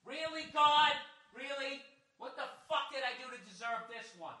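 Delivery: high, thin whining plea to God; whiny complaint to God. Retold or imitated. Alone in the garage complaining to God, high whiny voice. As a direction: whine up at the sky like a wronged child. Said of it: "I start doing that".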